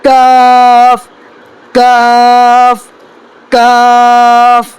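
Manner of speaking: slow model of کاف; drawn-out letter demo. Shown as a demonstration. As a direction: stretch each کاف slowly. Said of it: "یہ بڑی قاف اور چھوٹی کاف".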